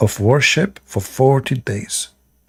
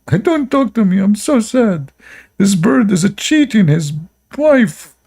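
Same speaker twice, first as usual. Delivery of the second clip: mock whiny high voice; 9 semitones higher than usual. Mock voice of weeping prophet.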